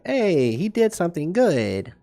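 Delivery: high, naive fan cheering; mock supporter voice. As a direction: pitch it up like a gullible supporter. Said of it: "will just be like".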